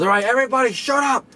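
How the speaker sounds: high, mock yell over a crowd; imitated crowd-silencing yell. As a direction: imitate a boss calling a noisy room to order. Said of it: "And then it starts getting loud again".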